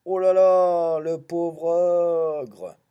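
high, drawn-out wail of dismay; loud lament. Acted. Storyteller pitying the poor ogre, loud lament. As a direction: wail it slowly at full voice.

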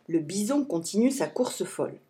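ordinary delivery, nothing marked

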